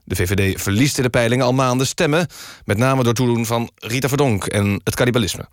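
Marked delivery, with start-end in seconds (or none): none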